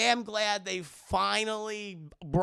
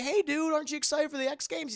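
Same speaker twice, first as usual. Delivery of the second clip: excited friends; 10 semitones higher than usual. Retold or imitated. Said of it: "they go up to Max and like".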